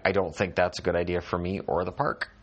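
ordinary talk

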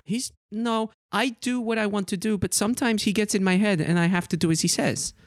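put-on high young woman pleading; tiefling explaining her master's hold; troubled tiefling. GM voices tiefling NPC.

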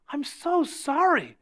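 high, slow, drawn-out sympathy; mock pity. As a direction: imitate someone gushing with pity. Woman pities him for being pastor.

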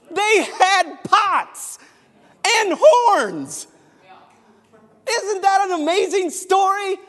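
high and loud, full-force preaching; fervent sermon shout. Real, not acted. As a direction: proclaim it to the back row.

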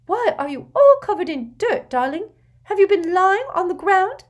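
high anxious fussing voice; fussing Aunt Petunia. Acted. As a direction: fret over him, high. Petunia fusses over son.

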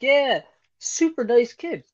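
hammed-up eager answer, drawn out; mock over-the-top enthusiasm; excited reply. Acting out gym guy's reply.

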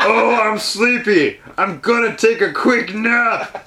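slow, drawn-out put-on voice; hammed-up sleepy character voice; mock ladyboy voice. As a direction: stretch every word in a comic put-on voice. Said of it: "this is my ladyboy voice".

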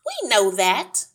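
loud, slow, irritated retort; ratty. A narrator's reading. Wife missing her program, ratty. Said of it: "ratty about missing her program".

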